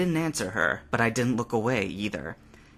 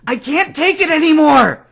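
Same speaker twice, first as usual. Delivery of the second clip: much higher, very loud outburst; anguished scream; 14 dB louder than usual. A narrator's reading. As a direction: let it all break out. Ash breaking down.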